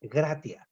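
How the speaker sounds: "Gratia" said GRA-tya, with a clear hard g and t; "Gratia" slowly, as a Latin term, with a hard g; "Gratia" with a hard g and a t, not the s of "gracia"; Latin word. Shown as a demonstration. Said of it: "es la palabra gratia".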